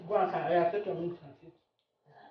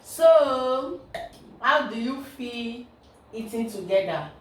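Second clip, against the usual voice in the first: vlog host; 5 semitones higher, 7 dB louder, slower than usual. Acted. Vlog sign-off.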